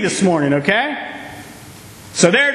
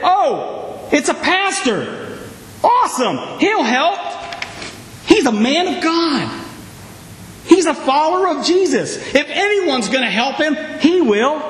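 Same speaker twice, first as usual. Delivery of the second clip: hopeful relief; 5 semitones higher than usual. Acted. Relief in imagined movie.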